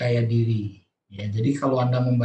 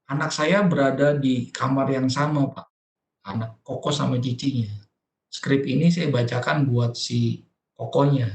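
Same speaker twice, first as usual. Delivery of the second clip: ordinary delivery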